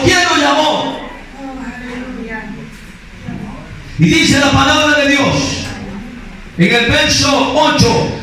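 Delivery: high, driving sermon delivery; impassioned preaching. Acted. Preacher pressing the congregation about God's call, impassioned. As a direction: preach it out in a high, urgent stream.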